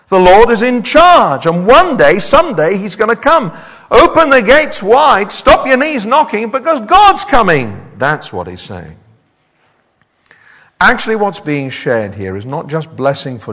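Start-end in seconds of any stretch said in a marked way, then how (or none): 0.1-7.9 rally the congregation with conviction; full-force preaching. Real, not acted. Preacher urges the congregation to open the gates, fervent.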